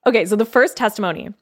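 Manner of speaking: ordinary talk, nothing marked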